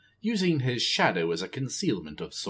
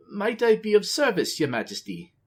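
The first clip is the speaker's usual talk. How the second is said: eager courtly offer; 11 semitones higher than usual. A narrator's reading. Zakan offers service to queen.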